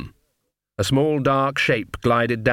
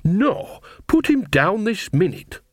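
high sharp scolding, much higher; 8 semitones higher, slower than usual. Acted. Nanny scolding her cat in the alley, high and sharp. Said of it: "her scolding voice".

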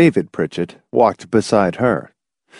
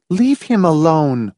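high, scolding wife; chastising; 7 semitones higher than usual. A narrator's reading. Wife rebuking her husband, chastising. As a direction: tell him off in a raised pitch. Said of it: "she chastised her husband".

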